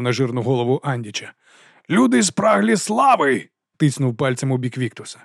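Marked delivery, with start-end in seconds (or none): 1.9-3.5 high, slow, drawn-out proclamation; ringing oration. A narrator's reading. Captain presents his officer to troops, grand ringing oratory. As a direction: stretch each word for the crowd. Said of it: "Його голос дзвенів".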